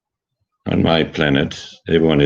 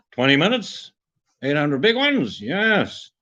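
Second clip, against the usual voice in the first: gleeful high voice; 11 semitones higher, slower than usual. Acted. Gloating over voiceover pay.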